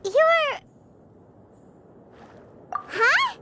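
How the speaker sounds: high, stunned disbelief; astonished. Acted. Paimon realises the bird lady is human, astonished and high. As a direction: gasp it out, can't believe it. Shock at the bird's human form.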